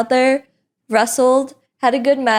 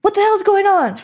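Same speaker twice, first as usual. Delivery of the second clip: higher, forceful imitation of a furious boss; retold angry shout, pitched up; angry Booker outburst; 10 semitones higher than usual. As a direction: barge in with mock outrage. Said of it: "He's like".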